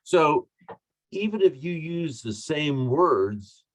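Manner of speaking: usual speaking voice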